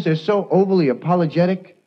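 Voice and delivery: ordinary talk, nothing marked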